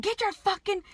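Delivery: breaking off mid-yell; panicked yell. Retold or imitated.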